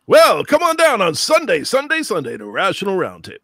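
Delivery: hammed-up radio announcer with a sing-song repeat. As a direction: sell it like a Sunday radio promo. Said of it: "like a natural radio announcer".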